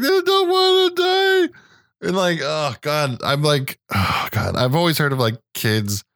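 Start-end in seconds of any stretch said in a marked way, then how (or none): 0.0-1.5 put-on very high, stretched-out child wail; hammed-up little-boy whine; seven-year-old son. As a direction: imitate a kid wailing, slowly. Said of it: "he was just like".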